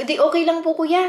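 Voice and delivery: lightly acted upbeat okay; cheerful reassurance. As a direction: reassure him brightly that it's fine. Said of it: "sabi ko".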